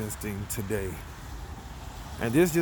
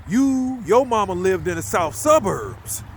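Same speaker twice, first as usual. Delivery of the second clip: louder, higher, slowing down; loud hold up; 5 semitones higher, 4 dB louder than usual. Retold or imitated. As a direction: halt him in disbelief. Confused, stopping friend, retold.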